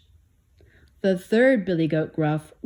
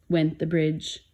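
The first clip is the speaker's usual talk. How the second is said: ordinary delivery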